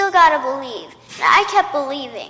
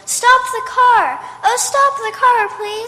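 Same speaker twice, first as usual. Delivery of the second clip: urgent excitement; 8 semitones higher, 4 dB louder than usual. Acted. Girl spotting the house, begging mother to stop car. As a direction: beg urgently, thrilled. Child spots her dream house.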